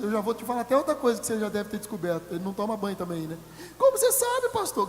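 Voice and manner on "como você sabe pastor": much higher, louder surprised question; mock surprised bride. Bride surprised the pastor knows, high squeal.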